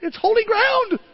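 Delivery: high, loud, mock-holy awe; hammed-up worship at the garage door; awed reverence. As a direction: proclaim it like a choir in a cathedral. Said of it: "I'm going".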